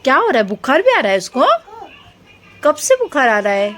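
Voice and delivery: high, loud questions in mock alarm; playful mock concern. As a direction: make a big worried fuss for a child's game. Playing along with child's sick-doll game.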